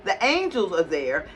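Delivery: ordinary delivery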